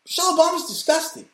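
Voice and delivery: sudden high-pitched squeal of disgust; disgusted outburst. Real, not acted. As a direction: blurt it out high and fast in revulsion.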